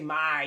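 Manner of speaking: "my" stretched out long; too long vowel. Shown as a demonstration. Teacher shows what we don't say, drawn out. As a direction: hold the full vowel in "my". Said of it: "it's too long".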